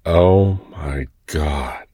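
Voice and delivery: slow, breathy sigh of awe; breathless. Acted. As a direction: let it out slowly, dazed. Lover overwhelmed by a kiss.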